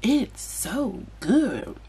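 slow, soft drawn-out so good; YouTuber impression. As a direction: breathe it slowly and soothingly. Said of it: "She be like".

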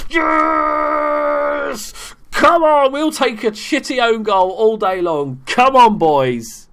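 high, loud triumphant shouting; real jubilant shout, easing at the end; goal celebration. Real, not acted. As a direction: yell it out as your team scores.

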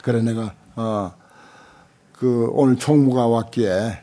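normal talking voice

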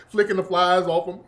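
ordinary talk, nothing marked

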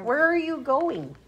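high-pitched pet-talk question; high, coaxing dog talk. Real, not acted. Talking to the moving dog.

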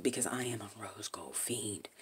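whispered confession; whispering. Real, not acted. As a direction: confide it in a whisper. Confession aside.

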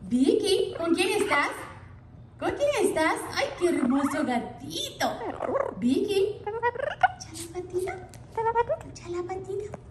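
high cooing to a parrot. Real, not acted. Owner coaxing her parrot in high baby talk.

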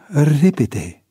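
high, short urging word; urgent prompt. A narrator's reading. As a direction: hurry a comrade along. Urging a comrade to act. (îndemn grăbit)

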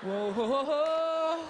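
drawn-out rising "whoa"; rolling with it. Shown as a demonstration. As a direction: demonstrate a placating whoa, sliding upward. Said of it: "You gotta, like".

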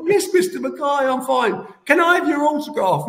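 put-on a star-struck young boy asking his hero; eager young boy. Boy on the floor asking Dave Mackay for an autograph, eager kid voice.

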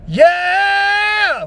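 high loud strained yeah; strained gravel scream. Shown as a demonstration. Showing the bad scream, high strained gravel yeah. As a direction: push the yeah hard until it strains. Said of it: "as opposed to trying to go".

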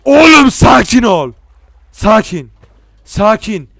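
high yell, then sharp repeated calls; very loud, high and urgent; alarmed shouting. Real, not acted. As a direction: shout to stop someone going wild. Yells at character to calm down.

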